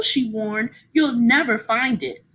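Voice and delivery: ordinary delivery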